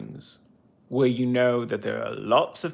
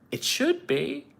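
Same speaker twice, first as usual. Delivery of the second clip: high-pitched protest; 14 semitones higher than usual. Real, not acted. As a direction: voice jumps up high, indignant. Indignant protest at unfinished case.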